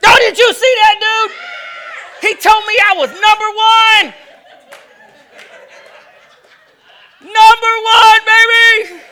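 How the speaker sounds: high, loud mock cheer; sarcastic shout. Preacher mocking a driver who flipped him off, shouting.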